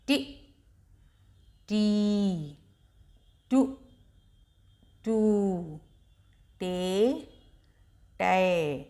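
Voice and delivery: slow syllable-by-syllable recitation; teacher reciting each syllable with a pause; barakhadi drill. Shown as a demonstration. Teacher drilling children on चा row, recited slowly. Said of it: "टा से टमाटर।".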